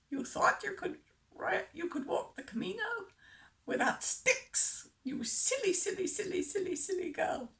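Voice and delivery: mock a Dutch wife tutting in her accent. Dutchman's wife teasing her about going without sticks, in Dutch accent. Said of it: "I'm sorry about the accent".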